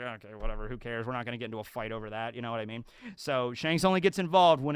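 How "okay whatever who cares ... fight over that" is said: low, soft, offhand shrug; mock indifference. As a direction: toss it off quietly, imitating someone unbothered. Crew shrugging off the bandit.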